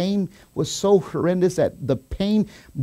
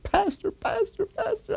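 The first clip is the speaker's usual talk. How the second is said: high, thin, drawn-out imitation; acted high-pitched plea; wounded man's urgent call; 8 dB quieter, slower than usual. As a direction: call for the pastor in a high, softer put-on voice. Voices wounded man greeting the pastor.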